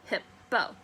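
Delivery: "Hippo" split in two, hip, then po; broken into syllables. Shown as a demonstration. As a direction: sound out "Hippo" slowly, beat by beat. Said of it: "Break it down".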